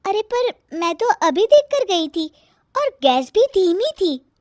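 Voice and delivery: put-on high-pitched young woman protesting; high, defensive explaining; sister-in-law, defending herself.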